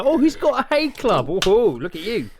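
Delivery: high delighted gasp; delighted surprise. Retold or imitated. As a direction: gush over the bottle. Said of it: "I kinda".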